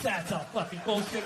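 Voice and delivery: ordinary talk, nothing marked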